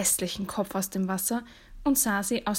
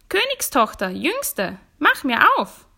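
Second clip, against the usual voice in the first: high, loud frog call; 6 semitones higher, 6 dB louder than usual. A narrator's reading. Frog calling at the princess's door, insistent call. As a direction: sing out the summons, loudest on the last phrase. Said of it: "klopfte es an die Tür und rief".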